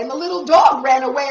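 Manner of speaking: ordinary delivery, nothing marked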